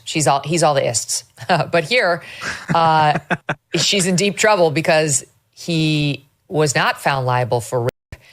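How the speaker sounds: ordinary talk, nothing marked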